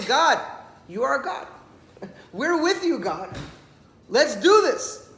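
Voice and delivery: rally yourself with God, eager; joyful address to God. Acted.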